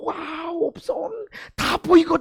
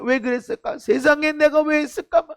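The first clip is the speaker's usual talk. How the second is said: ordinary talk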